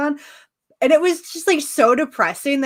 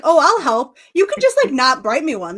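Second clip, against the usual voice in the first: much higher and louder cheerful offer; eager helper voice; 4 semitones higher, 6 dB louder than usual. Retold or imitated. Speaker voicing Ben offering to help Gwen, high and eager. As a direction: chirp it out, overly helpful.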